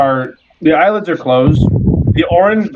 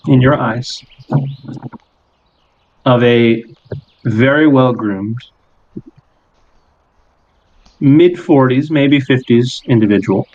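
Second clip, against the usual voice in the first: slow, measured scene-setting with long pauses; game master narration. Acted. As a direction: unveil the vision piece by piece, unhurried.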